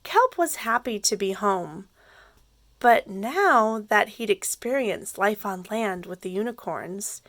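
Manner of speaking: ordinary delivery, nothing marked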